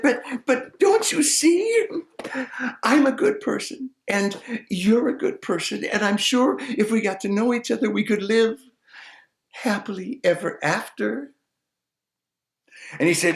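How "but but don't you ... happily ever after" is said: much higher pleading maiden. Acted. Maiden pleading with the simple man, higher and sweet.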